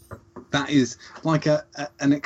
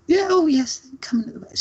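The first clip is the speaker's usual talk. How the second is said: put-on much higher, pleased old lady reply; imitating Dorothy. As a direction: answer like a proud elderly neighbour. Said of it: "she went".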